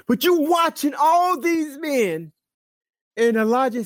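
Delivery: high, drawn-out ringing proclamation; sustained high preacher's declamation; full-force scripture preaching. Real, not acted. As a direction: cry out the prayer to a crowd, slowly.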